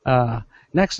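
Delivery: normal talking voice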